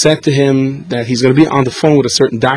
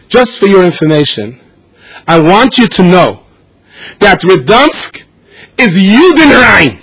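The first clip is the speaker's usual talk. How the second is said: louder, slowing on the final words; cruel officer; 5 semitones higher, 5 dB louder, slower than usual. Retold or imitated. Nazi officer telling the prisoner his town is Judenrein, slow cold announcement. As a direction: deliver it coldly, drawing it out.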